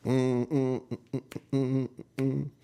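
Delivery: hummed searching murmur; humming. Real, not acted. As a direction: hum while rummaging. Looking for notes.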